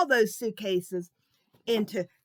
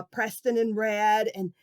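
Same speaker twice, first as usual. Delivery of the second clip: ordinary delivery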